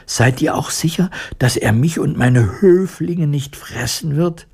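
high, anxious mouse queen. A narrator's reading. Mouse queen peeking from the grass, anxious. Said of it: "ängstlich".

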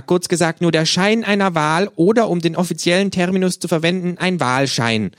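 high, loud ranting kangaroo. Acted. The kangaroo lectures the narrator on fake elections, high and loud. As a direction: lecture like a smug little revolutionary.

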